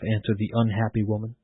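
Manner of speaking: ordinary delivery, nothing marked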